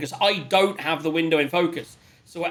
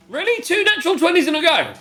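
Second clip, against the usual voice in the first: surprised; 11 semitones higher, 3 dB louder than usual. Real, not acted. Reacts to a second natural twenty.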